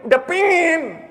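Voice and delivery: loud, high, insistent craving; intense longing. Acted. Preacher acting someone who craves heaven, loud eager outcry. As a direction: cry out the wanting with rising force. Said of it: "seperti orang yang pengen gitu".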